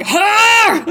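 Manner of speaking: very high, loud shriek; shouted catchphrase. Retold or imitated. Friend retelling backyard movie nights, shouted catchphrase. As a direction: shriek it out, drawing out the word. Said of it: "itty-bitty little face going".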